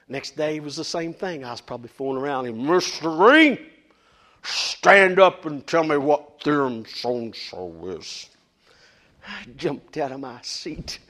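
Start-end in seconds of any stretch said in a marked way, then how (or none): 2.7-8.3 imitating teacher. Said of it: "talking in a monotone voice".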